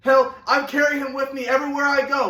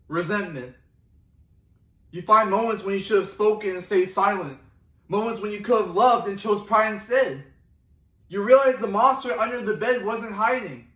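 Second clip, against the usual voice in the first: ordinary talk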